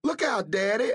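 high frightened scream; screaming a warning as the stove blows. A narrator's reading. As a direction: shriek a warning to her daddy. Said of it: "Amabel screamed".

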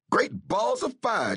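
high startled yell; startled yell at the exploding stove. A narrator's reading. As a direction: blurt it out in shock, drawn out. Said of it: "Jackson yelled".

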